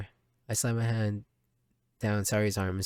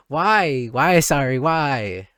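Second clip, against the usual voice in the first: dismayed why; 3 semitones higher, 9 dB louder, much slower than usual. Real, not acted. Reacting loudly to the game scene.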